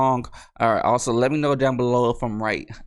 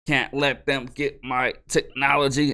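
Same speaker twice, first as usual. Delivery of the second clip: slow, halting robot impression; slower than usual. Robot in a firefight announces self-destruct, robotic voice. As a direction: measure out the words like a droid. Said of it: "so the robot's like".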